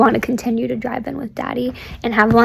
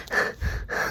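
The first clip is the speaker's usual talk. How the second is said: imitated shaky sigh; 14 dB quieter than usual. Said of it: "she was just kinda like".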